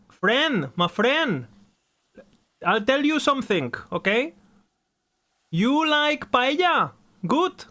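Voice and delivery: high-pitched hammed-up Spanish accent; mock Spanish accent. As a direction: play a pushy Spanish friend with a thick accent. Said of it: "That's the Spanish accent".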